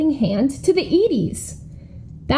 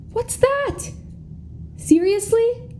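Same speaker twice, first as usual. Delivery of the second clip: dismayed surprise; 9 semitones higher, slower than usual. Acted. Reacts to offstage news about seal.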